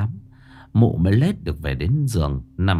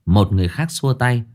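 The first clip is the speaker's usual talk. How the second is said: plain reading voice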